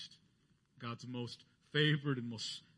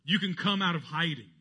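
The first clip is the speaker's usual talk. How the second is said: calling out; 4 semitones higher, 8 dB louder than usual. Retold or imitated. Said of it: "he said to the shepherds".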